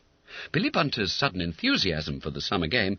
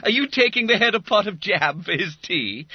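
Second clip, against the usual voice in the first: teasing schoolboy; 13 semitones higher, 6 dB louder than usual. A narrator's reading. Bob Cherry teasing.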